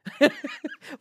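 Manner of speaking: high bright burst of laughter; real laugh. Real, not acted. Laughing at her own answer to the Greek. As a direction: laugh out at your own punchline.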